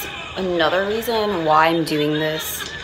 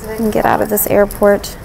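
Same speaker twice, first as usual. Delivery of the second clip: ordinary talk, nothing marked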